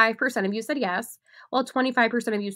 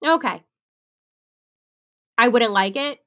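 ordinary delivery

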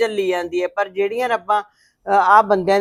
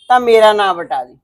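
angry vow; 7 dB louder than usual. Acted. Aunt curses the in-laws.

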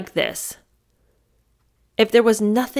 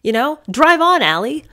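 pretend yell at Allie; 6 semitones higher, 3 dB louder than usual. Yelling advice at Allie in car.

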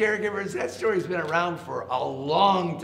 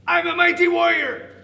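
high, loud warrior boast; big boastful voice; 11 dB louder than usual. A narrator's reading. Hidden caterpillar warning the frog, loud boastful voice. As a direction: boom it out high to scare him. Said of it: "the voice from inside the log said".

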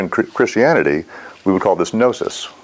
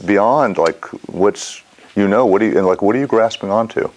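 usual speaking voice

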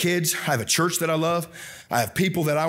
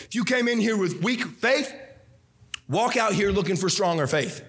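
ordinary talk

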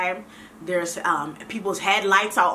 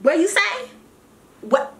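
higher, sharp what-did-you-say challenge; mock sassy retort; 10 semitones higher, 4 dB louder than usual. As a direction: play the offended woman ready to fight, laughing. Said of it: "I might have went into fighting mode".

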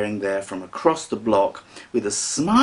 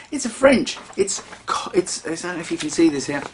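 ordinary talk, nothing marked

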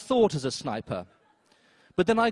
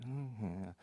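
resigned mutter; 5 semitones lower, 14 dB quieter than usual. Retold or imitated. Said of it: "I went".

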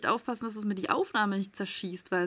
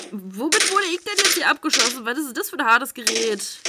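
alarmed loud outcry; 2 semitones higher, 7 dB louder than usual. Real, not acted. Streamer under fire, loud alarmed outcry. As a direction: shout in disbelief at the weapon. Being shot at.

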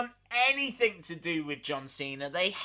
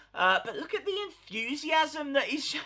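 ordinary talk, nothing marked

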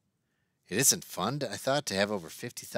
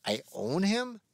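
1 surprised question; 9 semitones higher, slower than usual. A narrator's reading. Boy stunned by news.

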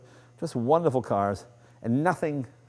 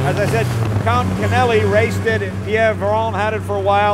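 talking loudly and higher over a roaring engine; raised voice over engine; 11 semitones higher, 9 dB louder than usual. Real, not acted. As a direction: shout over the motor while driving. Talking while driving over engine noise.

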